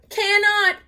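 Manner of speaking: put-on loud, high childish retort; shouting. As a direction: yell it back, high. Kids arguing over berries.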